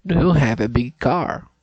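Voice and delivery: loud, deliberate speech for the app; practicing a sentence for the pronunciation app, loud and deliberate; loud careful repeat. Shown as a demonstration. Saying it loud for the machine to catch. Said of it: "É, não deu".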